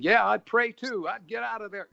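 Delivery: high, loud mock heckle; imitated audience yelling. Audience member talking back to the movie, mock yelling. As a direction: yell it like a moviegoer at the screen. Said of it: "you know, screaming and yelling".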